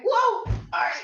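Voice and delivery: surprised whoa, then a quick laughing report; startled shout. Real, not acted. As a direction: yelp while tipping over. Losing balance in pose.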